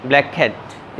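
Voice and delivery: "ब्लैक कैट" run together as one k, blek-at; "ब्लैक कैट" with one shared k sound; linked words. Shown as a demonstration. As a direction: drop the doubled k in "ब्लैक कैट". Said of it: "के sound है उसको drop कर देना है".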